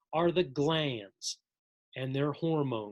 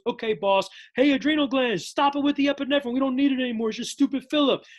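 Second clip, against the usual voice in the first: higher and louder, shooing the glands off; bossy relay; 7 semitones higher, 5 dB louder, faster than usual. Acted. Pituitary telling adrenal glands to stop, higher and louder. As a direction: wave it off, false alarm.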